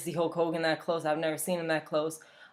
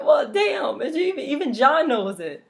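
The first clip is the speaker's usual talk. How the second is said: laughing exasperation; 9 semitones higher, 6 dB louder than usual. Retold or imitated. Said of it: "I was like".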